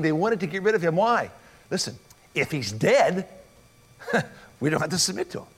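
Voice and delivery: ordinary delivery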